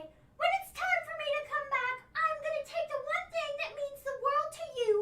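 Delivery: high, slow fake sobbing; drawn-out tearful wail; crying impression. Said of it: "Sasha Banks crying impression".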